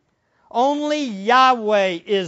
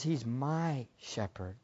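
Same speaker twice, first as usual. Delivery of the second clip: soft, slow, intimate; hushed quote; 8 semitones lower, 13 dB quieter, slower than usual. Acted. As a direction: breathe David's words quietly. Quoting David softly.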